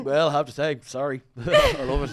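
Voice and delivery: imitating a Dublin 4 lad, laughing; Dublin 4 accent. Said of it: "Dublin four accent that you're doing".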